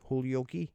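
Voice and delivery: Holyoke as HOLE-ee-OH-kee, rising; trying out pronunciation. Shown as a demonstration. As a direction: say Holyoke with a long O and a final "kee". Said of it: "I know I'm saying it wrong".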